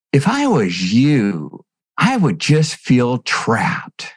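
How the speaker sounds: slow, drawn-out words; slow brother quote. Retold or imitated. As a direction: let each word hang, slowly. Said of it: "And he goes".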